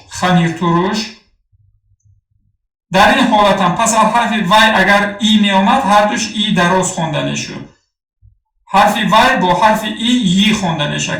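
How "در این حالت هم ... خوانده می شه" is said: ordinary delivery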